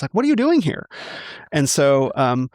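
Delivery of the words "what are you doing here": very high, swooping retold question; incredulous questioning. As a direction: act out total bafflement at strangers. Retold baffled question to the missionaries.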